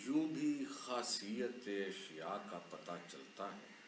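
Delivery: slow, measured ghazal reading; poem recitation. Acted. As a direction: recite the couplet slowly. Poet recites his ghazal.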